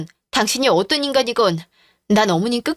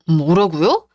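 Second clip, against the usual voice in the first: shocked; 5 semitones lower, slower than usual. Acted. Shock at will condition.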